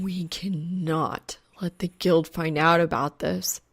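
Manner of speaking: slow, unvoiced wheezy whisper; a breathless, slow wheeze; wheezed. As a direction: wheeze the words out in a panic. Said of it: "Soul wheezes".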